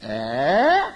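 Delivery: eh rising, pressed hard; emphasis on eh. Shown as a demonstration. Teacher shows where the verse's emphasis falls, stressed eh. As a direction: lean on eh as a question. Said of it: "Emphasis is on eh".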